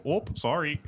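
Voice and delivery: quick polite apology in a Midwestern accent. Shown as a demonstration. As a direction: bump into someone, apologize in Midwestern. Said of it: "what do you say when someone bumps into you".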